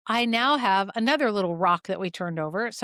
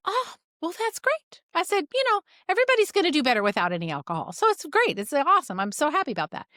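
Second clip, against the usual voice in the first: high-pitched, pleased reaction; delighted; 7 semitones higher than usual. Retold or imitated. Practitioner cheering a patient who quit alcohol, delighted. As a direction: re-enact being thrilled at good news, pitch leaping up.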